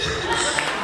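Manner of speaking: short breathy laugh; a quick real laugh. Real, not acted. Laughing at the memory.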